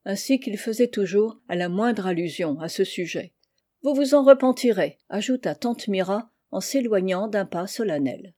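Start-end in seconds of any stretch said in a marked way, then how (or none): 3.8-5.0 higher, louder solemn warning. A narrator's reading. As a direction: deliver a parting warning, pitched up and forceful. Aunt's parting warning to uncle.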